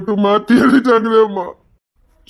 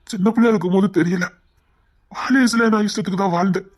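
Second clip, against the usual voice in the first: ordinary talk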